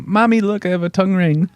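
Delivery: put-on high, excited little kid. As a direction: squeal it like a proud child.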